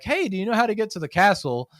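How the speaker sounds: a hint of a lost American tourist; lightly voiced tourist asking.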